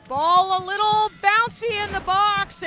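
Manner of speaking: shouting the goal, pitch way up; excited goal call. Real, not acted. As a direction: erupt with joy at the goal. Goal scored, commentator erupts.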